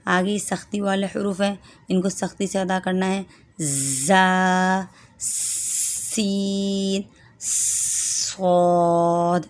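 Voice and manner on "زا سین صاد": زا سین صاد drawn very long with a hissing whistle; sharp whistled letter demo; whistling letters. Shown as a demonstration. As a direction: hiss each letter hard and long. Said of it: "شروع میں سیٹی کی آواز نکالنی ہے".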